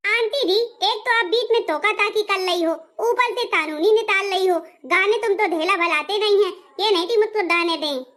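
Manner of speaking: an indignant complaint said with a lisp; lisping bride. Acted. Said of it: "तोतला के गाऊंगी और तोतला के बोलूंगी". (बीच में टोकने पर तोतली झुंझलाहट)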